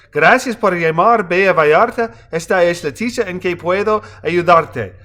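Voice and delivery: a Mexican hotel receptionist picking up a guest's call, brisk and polite; Spanish hotel clerk. A narrator's reading. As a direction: answer the phone as a cheerful Spanish-speaking receptionist. Hotel clerk answers the phone.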